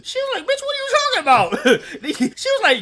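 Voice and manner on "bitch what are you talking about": high, fast, sassy disbelief; incredulous snap. Retold or imitated. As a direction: fire it back fast and high. Acting out reply to lunch lady.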